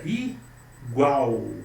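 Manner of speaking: "igual" in two beats, short i, long strong GUAL; stress on GUAL. Shown as a demonstration. Teacher showing the tonic syllable, "igual" stressed on GUAL. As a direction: pause inside "igual" and lean on GUAL. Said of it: "tônica".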